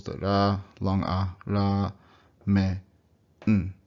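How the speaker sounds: ramen split ra, a, me, n with pauses; syllable by syllable. Shown as a demonstration. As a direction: count the beats of ramen aloud. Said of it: "four syllables".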